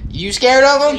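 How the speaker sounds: loud mocking repeat of the question; mocking echo. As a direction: throw the question back, mocking.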